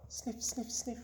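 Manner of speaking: soft quick sniffs; mouse sniffing. Acted. Narrator sniffs as the little mouse.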